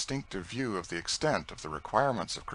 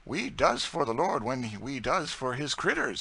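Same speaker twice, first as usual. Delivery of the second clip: higher, louder humble servant voice; Tom's earnest voice; 7 semitones higher, 3 dB louder than usual. Acted. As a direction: explain humbly but with conviction. Tom's reply.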